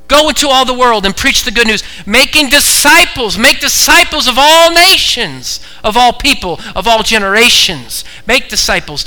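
loud, high, rousing proclamation; full-force preaching. Real, not acted. Preacher sending believers to all nations, full-force preaching. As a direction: ring out each phrase like a rallying preacher.

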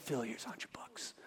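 hushed, secretive whisper. Retold or imitated. Preacher slipping Phil money before the service, whispered. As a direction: whisper it so nobody else hears. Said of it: "I whispered to Phil".

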